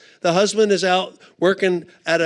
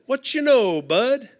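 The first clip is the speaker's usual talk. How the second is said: higher, cheery buddy greeting; friendly Bud greeting; 5 semitones higher than usual. Retold or imitated. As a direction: sing out the hello to a lady. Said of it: "lady or gentleman".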